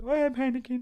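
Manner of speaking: high, put-on fretting; hammed-up worry; lightly voiced mock panic. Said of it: "I'm getting a bit".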